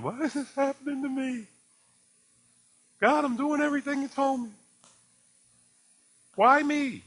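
high whining complaint, rising to a near cry; mock whining. Believer whining to God over bad news, high and pleading. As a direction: whine it like a self-pitying believer. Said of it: "And you start whining".

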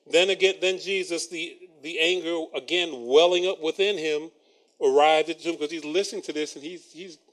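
normal talking voice

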